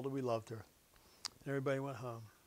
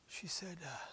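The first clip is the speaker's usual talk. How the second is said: ordinary talk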